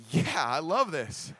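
long drawn-out, savoring approval; retold delighted cheer. Wife watches the bully get justice, slow delighted cheer. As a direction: imitate a slow, gleeful cheer.

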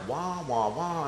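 soft droning nonsense syllables; mock parent-speech wah-wah. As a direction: imitate a lecture heard as meaningless noise. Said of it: "she's looking at me like".

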